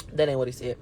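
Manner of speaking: deep, quiet comic undertone; joking aside. Pretend. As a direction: drop way down to take it back. Jokey aside retracting the claim.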